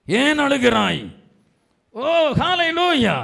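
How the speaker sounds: loud, high preacher's shout; fervent cry. Real, not acted. Preacher asks the weeping why, fervent shout. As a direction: cry it out at full voice.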